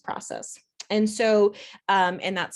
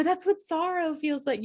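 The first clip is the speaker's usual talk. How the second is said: much higher, wondering realization; dramatic elation; 8 semitones higher than usual. Retold or imitated. Journaling in her car, dramatic amazed realization. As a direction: savor the discovery dramatically. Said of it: "a little bit on the dramatic side".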